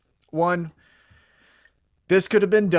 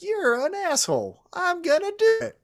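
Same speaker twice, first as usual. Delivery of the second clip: squeaky high mocking voice; mock high-pitched defiance. Wrestler telling her teammate off, in a mock squeaky voice. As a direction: toss it off high and bratty. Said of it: "she's like".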